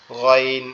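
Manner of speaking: গইন said from the back of the throat, gargled; Arabic letter ghain. Shown as a demonstration. Teacher demonstrates the letter গইন. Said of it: "এরকম মনে হবে".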